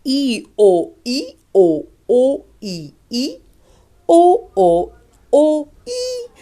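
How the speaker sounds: loud, playful drill of "I" and "O" climbing high at the end; loud sing-song drill of "O" and "I"; playful vowel drill. Shown as a demonstration. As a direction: make the vowels a game for the child. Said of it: "Vous pouvez chanter I".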